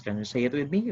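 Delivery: usual speaking voice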